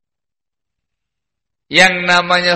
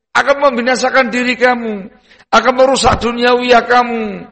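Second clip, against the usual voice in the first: urgent warning; 7 semitones higher than usual. Acted. Preacher voicing a loving warning.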